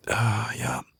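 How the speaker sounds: breathy whispered "ah, ya"; whispered aside. Acted. Captor whispers an aside while negotiating, hushed. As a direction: whisper it half to yourself.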